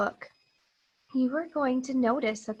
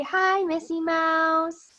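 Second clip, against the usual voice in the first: put-on slow, higher greeting for kids to copy; slow model greeting; 5 semitones higher, slower than usual. Shown as a demonstration. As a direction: say hi sweetly and slowly. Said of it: "Can you say".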